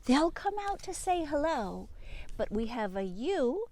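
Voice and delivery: ordinary talk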